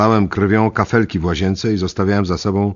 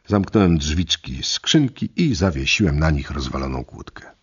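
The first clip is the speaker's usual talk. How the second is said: plain reading voice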